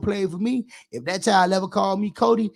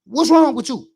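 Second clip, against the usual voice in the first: higher, louder scolding that builds; scolding a girl; 3 semitones higher, 6 dB louder than usual. Retold or imitated. A woman scolding a fast little girl, rising and louder. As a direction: call her over and chew her out. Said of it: "women gotta step down on these little girls".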